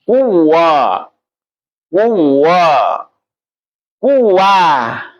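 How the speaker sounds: drawn out open Kuah; open-throat vowel. Shown as a demonstration. Teacher showing the students the full open vowel. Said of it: "Ini harus belakang".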